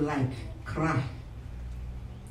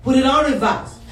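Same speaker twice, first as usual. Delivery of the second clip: loud, higher-pitched command; emphatic repeat; 5 semitones higher, 6 dB louder than usual. Real, not acted. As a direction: punch the instruction out to the congregation. Louder repeat after "I said what?.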